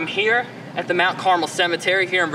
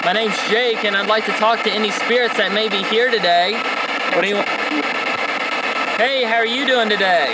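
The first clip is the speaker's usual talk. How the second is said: calling out to spirits; 4 semitones higher than usual. Acted. Investigator addressing spirits at the cemetery, a little raised. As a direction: call out to anyone listening.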